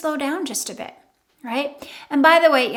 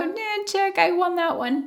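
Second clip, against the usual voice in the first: smug win; 6 semitones higher than usual. Retold or imitated. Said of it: "you can just go".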